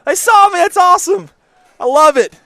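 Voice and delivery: high, loud, thrilled reading of the comment; excited shout. Retold or imitated. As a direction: yell it like the fan would. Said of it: "Cameron Roach says".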